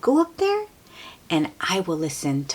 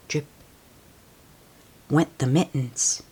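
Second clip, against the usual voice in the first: plain reading voice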